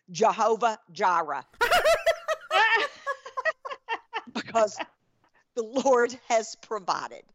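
put-on coworker's accent, laughing slightly through "Jehovah Jireh.". Coworker declaring it in her own accent. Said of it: "in exactly this accent".

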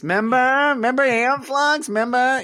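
high, slow and dreamy member berries. Retold or imitated. Imitating South Park member berries.

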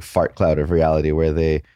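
ordinary talk, nothing marked

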